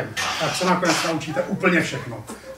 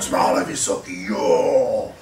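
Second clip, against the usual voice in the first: much lower, drawn-out big voice; playing huge; 7 semitones lower, slower than usual. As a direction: sound as tall and massive as possible. Dělají se obrovskými jako hlídací pes. (hluboký hlas obra)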